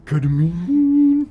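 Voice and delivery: stretched squeaky Ketamine; drawn-out cartoon squeal; silly high voice. Echoes the silly voice.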